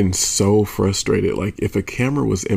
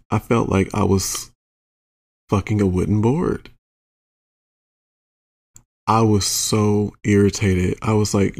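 normal talking voice

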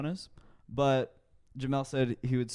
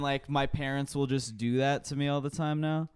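ordinary talk, nothing marked

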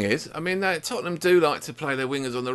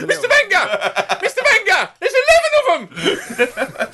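very high squealing mock alarm; hammed-up wail for the boss, last words drawn out; panicky corporal; 4 dB louder, slower than usual. Corporal warns Mister Wenger of opponents, shrieking comic panic.

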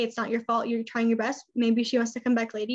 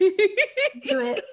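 laughing; 10 semitones higher, 5 dB louder than usual. Real, not acted. Urging listeners with a laugh.

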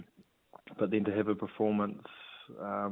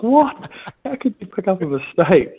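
high, loud disbelieving what; shrill, loud mock disbelief; incredulous; 11 semitones higher, 9 dB louder than usual. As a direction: react as if stunned by dad's call. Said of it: "I'm like".

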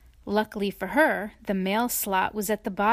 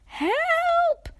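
very high, squeaky cry for help; tiny shriek. A narrator's reading. Tiny cornered by the farm cat, tiny shriek. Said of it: "shrieked little Miss Tiny at the top".

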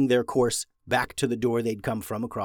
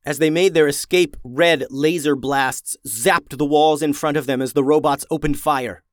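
ordinary delivery, nothing marked

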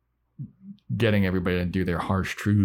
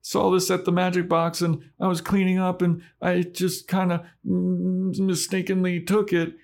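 put-on higher, sheepish girl confession; high Dawn voice; 12 semitones higher than usual. Dawn confessing she took the necklace, high and sheepish. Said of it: "Dawn's like".

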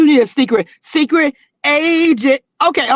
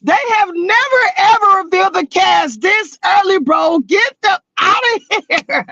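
high gloating shout, half laughing; excited shout; 6 semitones higher than usual. Real, not acted. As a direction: shout it out in triumph. Gloating over early cast reveal.

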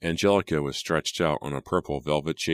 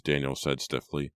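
usual speaking voice

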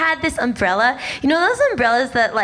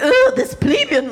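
snobby; 12 semitones higher, 3 dB louder than usual. Retold or imitated. Snobby inner voice.

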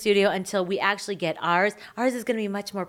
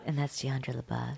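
ASMR whisper; 8 semitones lower, 11 dB quieter than usual. Shown as a demonstration. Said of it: "we're gonna do A S M R versions".

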